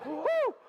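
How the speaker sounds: high, loud "woo" with laughter; excited whoop. Real, not acted. Speaker relieved to find the story, excited whoop. Celebrates finding thread again.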